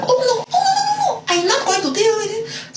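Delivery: very high squealing no no no; refusing to deal with the yelling boyfriend, high and laughing; dramatic refusal. Retold or imitated. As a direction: flounce off dramatically. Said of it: "I was like".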